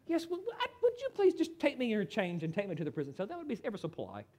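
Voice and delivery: hammed-up courteous criminal, soft and quick; mock polite. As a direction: pretend to surrender ever so politely. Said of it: "They're not very polite and courteous".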